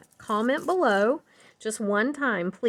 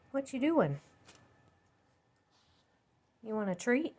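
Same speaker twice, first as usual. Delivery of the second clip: higher, sing-song voice for the dog; talking to a dog; 3 semitones higher, 4 dB quieter than usual. Real, not acted. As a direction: coo at the dog. Talking to her dog Sadie.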